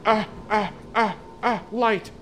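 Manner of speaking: high loud panicked gasping toward light; frightened gasps. Real, not acted. Player spotting light while fleeing, frightened gasps. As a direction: gasp in fear while running.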